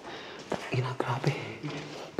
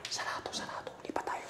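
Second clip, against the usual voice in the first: hushed scared whisper; frightened whisper about a voice; 19 dB quieter than usual. Real, not acted. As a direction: whisper it so nobody hears. Hushed exploring.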